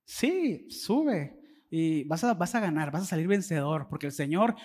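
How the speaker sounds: a hushed, sarcastic yes-man reply. Acted. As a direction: drag it out with quiet irony. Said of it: "le respondió con sarcasmo".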